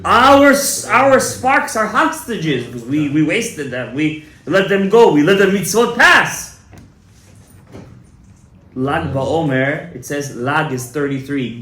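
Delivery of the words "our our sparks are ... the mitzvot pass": loud, high, urgent preaching; preaching at full force. Acted. Rabbi urging the congregation to pray that night, in full preaching force. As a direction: drive every instruction home to the crowd.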